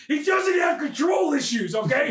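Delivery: sarcastic insistence. Acted. Sarcastic defence of Howard.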